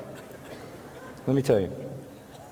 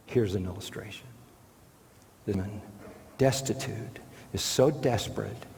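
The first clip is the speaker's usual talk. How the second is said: softer, slower, grave; hushed gravity; 5 semitones lower, 5 dB quieter than usual. Real, not acted. Preacher turning solemn to the congregation, softer and slow. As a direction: drop low to let it sink in. Solemn drop after peak.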